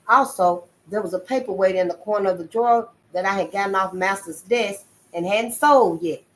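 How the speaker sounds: usual speaking voice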